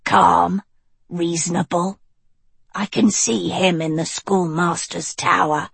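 put-on cool, level young witch; Annadil voice.

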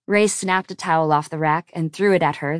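plain reading voice

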